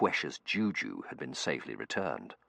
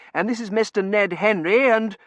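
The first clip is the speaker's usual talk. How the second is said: put-on young man voice; 11 dB louder than usual. Terence character voice.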